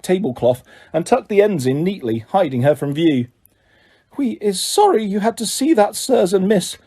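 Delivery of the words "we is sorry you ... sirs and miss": higher, apologetic house-elf voice; apologize, head hanging; high sorry house-elf voice; ashamed elf squeak. A narrator's reading. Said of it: "squeaked a nearby elf".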